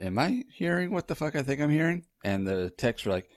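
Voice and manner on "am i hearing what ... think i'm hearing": high-pitched, incredulous questioning; mock stunned voice, pitched well up; imitated disbelief. Husband voicing wife hearing the heartbeat, high-pitched disbelief.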